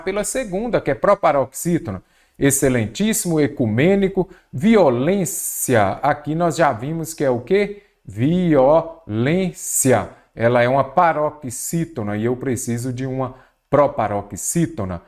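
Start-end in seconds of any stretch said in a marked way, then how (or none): 4.5-6.0 "violência" slowly stretched, long on LÊN; drawn-out syllables. Shown as a demonstration. Teacher working through the options, violência slowly sounded out. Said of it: "vamos separar a palavra em sílaba". 8.0-10.1 "Violência" very slowly split vi-o-lên-cia; syllable by syllable. Shown as a demonstration. Teacher reminding students it is paroxítona, violência split syllable by syllable. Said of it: "vamos separar a palavra em sílaba".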